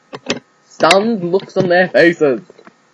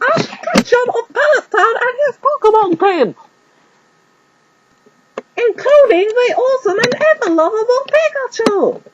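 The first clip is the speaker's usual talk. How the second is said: stadium announcer; 4 dB louder than usual. Acted. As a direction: open the show to the crowd. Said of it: "he announced".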